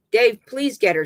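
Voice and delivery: high, loud desperate plea; crying out. A narrator's reading. River begging Dave for help, crying out. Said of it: "she cries".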